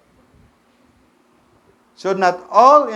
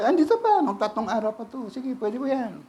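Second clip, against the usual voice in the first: a lightly voiced, higher neighbor; neighbor's reply; 7 semitones higher, 3 dB quieter than usual. Neighbor answers the caller about three-day-old food, lightly voiced. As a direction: play the neighbor offering leftovers.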